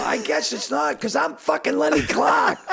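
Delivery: loud, high-pitched punchline outburst; exasperated shouted comeback. Retold or imitated. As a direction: shout it back in indignant disbelief. Acted comeback at the failed audition.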